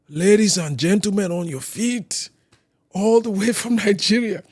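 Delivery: high, ringing mock announcer introduction; hammed-up showman's build-up; hype stage announcer. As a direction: imitate an MC introducing the star act.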